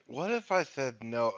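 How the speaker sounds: deadpan imitation. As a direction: imitate a joke with no joking inflection. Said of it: "said it completely deadpan with no fucking inflection".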